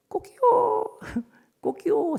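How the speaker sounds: high mock rooster crow then a laugh. Preacher imitates the rooster for the congregation, high crow. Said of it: "닭이 울으니까".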